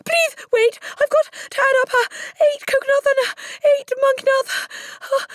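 put-on squeaky, tearful little boy in panic; flustered. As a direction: stumble through the sum, very high and pleading. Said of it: "Poor Wilfred was properly flustered".

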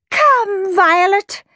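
high-pitched, imperious mother's summons; haughty mother. A narrator's reading. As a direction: summon your child grandly in a high voice. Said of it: "she added, sweeping from the room".

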